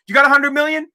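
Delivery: ordinary talk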